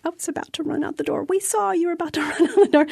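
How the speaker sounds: quick, high quote breaking into laughter; laughing retold confession. Participant and circle on her near escape, laughing retelling. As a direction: rush it high, laugh bubbling up. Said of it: "And she's like".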